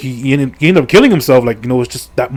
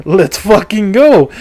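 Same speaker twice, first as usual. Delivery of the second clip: higher, slow and drawn out with glee; mock excited cheer; 6 semitones higher, slower than usual. Gamer retelling a tentacle monster fight, hammed-up slow delight. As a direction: savour it like a gleeful fan. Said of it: "so I'm here getting excited again".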